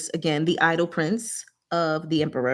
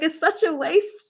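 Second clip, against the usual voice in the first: mock exclaim; 10 semitones higher than usual. Friend retelling a novel quote, hammed-up high exclaim. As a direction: cry out over the waste, hammed up. Acted quote, shout heard.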